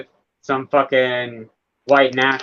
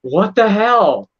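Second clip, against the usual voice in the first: much higher, very slow, louder disbelief; incredulous exclamation; 7 semitones higher, 4 dB louder, much slower than usual. Retold or imitated. Reacting to smiling people, slow incredulous what the hell. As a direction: stretch it out, baffled. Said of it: "It's just like".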